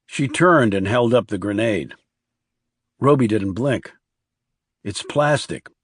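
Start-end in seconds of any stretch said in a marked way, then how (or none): 4.8-5.7 put-on grown-up girl's voice. A narrator's reading. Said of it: "Her voice was lower than Roby would have expected, more grown up".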